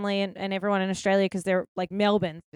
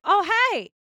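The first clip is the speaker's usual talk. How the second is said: high-pitched, louder friendly hello; excited greeting; 10 semitones higher, 6 dB louder than usual. Acted. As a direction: greet a fellow Aussie with a big, high hello. Acted friendly greeting between Aussies.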